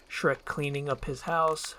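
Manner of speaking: ordinary talk, nothing marked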